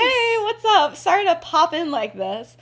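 high, loud, casual hello; breezy greeting. Acted. James popping in on the family, breezy. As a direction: sing out an over-casual apology.

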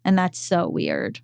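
ordinary talk, nothing marked

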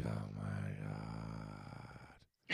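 drawn-out, very low and soft groan of words; appalled, low and slow reaction; hushed dismay. Retold or imitated. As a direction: let it out slowly, barely voiced. Reacting to a sweater seen.

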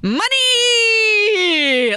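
high, loud, drawn-out swoop on money; big up and down voice. Retold or imitated. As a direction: sing money out like a hammed-up showman. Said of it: "big up and down in his voice".

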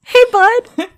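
high, bright buddy voice; mock greeting. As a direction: greet him like an old pal, high. Greeting Tom King in a put-on voice.

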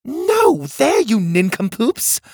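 high, loud scolding shout; exasperated yell. A narrator's reading. Cog girl scolding the boys' bad guesses, loud exasperated yell. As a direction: snap at them for getting it wrong. Girl yelling at clueless helpers.

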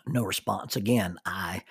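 ordinary talk

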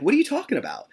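high, incredulous retold question; retelling his confused reply to his lawyer; baffled.

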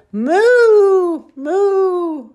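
long drawn-out, falling moo; drawn-out cow imitation. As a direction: moo loud and high like a cow. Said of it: "a sound like a cow".

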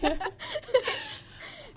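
high-pitched laughter after a scare; lingering laughter. Real, not acted. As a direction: keep laughing helplessly after a fright.

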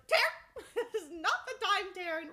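high, loud, laughing protest; laughing exasperation. Acted. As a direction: laugh out the scolding at the dragon. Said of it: "And she was like".

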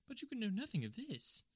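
high-pitched, anxious little man; flustered, high disbelief; nervous protest. Acted.

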